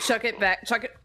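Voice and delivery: urgent call. Acted. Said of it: "We'll say".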